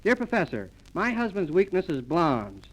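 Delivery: ordinary talk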